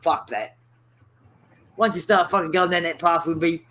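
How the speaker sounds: ordinary talk, nothing marked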